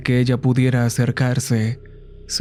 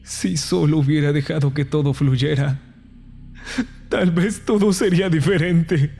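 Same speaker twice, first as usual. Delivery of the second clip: tearful regret rising into higher sobs; sobbing; 4 semitones higher than usual. Acted. Brother blaming himself, sobbing uncontrollably. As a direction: let the crying overtake the words. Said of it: "Empecé a sollozar. No pude contenerme".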